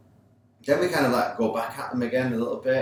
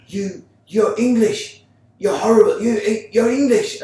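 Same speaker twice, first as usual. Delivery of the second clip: high, loud, slow mock ranting referee; hammed-up referee ranting at the skipper; 11 semitones higher, 9 dB louder than usual. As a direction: ham up the ref's foreign rant. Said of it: "started calling my nationality".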